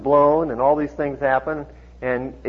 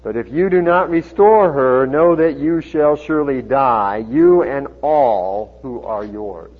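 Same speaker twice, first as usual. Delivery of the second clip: loud, slow solemn warning; projected, weighty Bible reading; scripture reading; 6 dB louder, slower than usual. A narrator's reading. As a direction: deliver God's warning deliberately from the pulpit. Reads scripture aloud to congregation.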